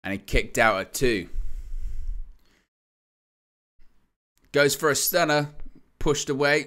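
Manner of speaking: live ringside play-by-play; wrestling commentator. Real, not acted. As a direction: call the near-falls, building hype. Live play-by-play of tag match.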